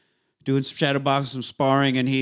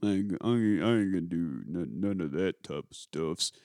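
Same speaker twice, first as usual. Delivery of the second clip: low, quiet, halting mumble; Rocky impression; 8 semitones lower, 8 dB quieter than usual. Rocky refusing the doctor's treatment, low quiet impression. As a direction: refuse softly in a low boxer's voice.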